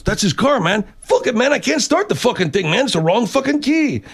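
high-pitched, exasperated rant; agitated valet. Retold or imitated. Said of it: "And he goes".

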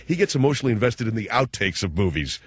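ordinary delivery

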